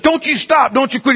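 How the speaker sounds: high, forceful command; urgent coach yelling. Retold or imitated. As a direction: drive a flagging player on. Coach urges stopping player.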